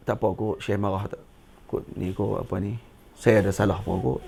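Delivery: low, soft self-questioning; hushed, low voice of a puzzled pupil; pupil's private wondering. Acted. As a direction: wonder to yourself, very quietly. Acts scolded pupil wondering to himself.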